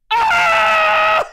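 shrill battle cry, very high; high battle scream. Acted. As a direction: scream as the blow lands. Bar fight climax.

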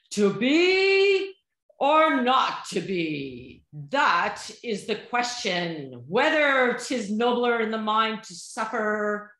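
hammy novice actor doing a soliloquy; amateur Shakespearean acting. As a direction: overblown Hamlet recital, vowels stretched long. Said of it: "I'm taking acting lessons".

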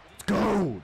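high, loud burst; excited shout. Real, not acted. Gamer hyped by his defense, excited shout. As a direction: burst out in celebration.

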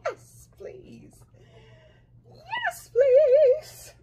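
high squeal of delight, breaking; excited squeal. Real, not acted. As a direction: squeal with joy over it.

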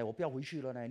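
usual speaking voice

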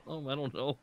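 normal talking voice

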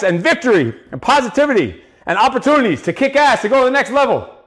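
high, driving pep talk; motivational rant. Real, not acted. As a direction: fire them up, pushing every phrase. Coach's motivational rant to viewers.

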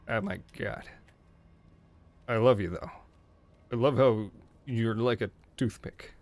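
normal talking voice

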